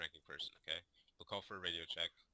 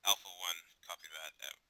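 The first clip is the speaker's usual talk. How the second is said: crackly military radio; 6 dB louder, much slower than usual. Shown as a demonstration. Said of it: "respond with".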